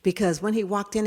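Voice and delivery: ordinary talk, nothing marked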